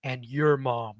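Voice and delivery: low, solemn retold confession; dead serious. Big friend confessing his fear to the storyteller, dead serious. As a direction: imitate a big friend admitting it gravely. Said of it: "with the most serious look".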